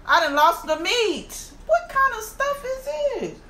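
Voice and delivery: high wail climbing to a squeal; exasperated dismay. Real, not acted. Eater who just lost the meat, wailing in dismay. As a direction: wail in high-pitched disbelief at the loss.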